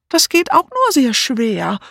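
high whiny lament; complaining. A narrator's reading. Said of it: "klagte Amy".

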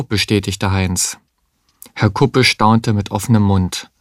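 plain reading voice

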